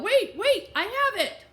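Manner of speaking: high, loud urgent yell. A narrator's reading. Racing student yells from the hallway to the office. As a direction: call out high and loud to stop someone. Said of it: "heard someone yell".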